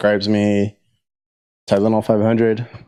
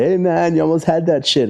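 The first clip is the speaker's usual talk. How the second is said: higher, breezy mock of the young gym kid; imitating the kid; 8 semitones higher than usual. Mocking the kid who beat him, higher breezy voice. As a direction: lightly voice the cocky nineteen-year-old.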